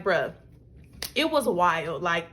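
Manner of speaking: ordinary talk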